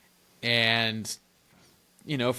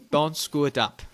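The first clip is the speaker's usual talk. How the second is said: put-on light Nigerian accent; a hint of a Nigerian priest; 3 semitones higher, 3 dB louder than usual. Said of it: "In his Nigerian accent".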